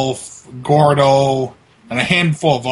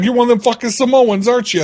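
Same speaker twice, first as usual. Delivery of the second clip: higher-pitched retold challenge; mock accusation; 8 semitones higher, 3 dB louder, faster than usual. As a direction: imitate an indignant accuser. Retold accusation from prank call victim.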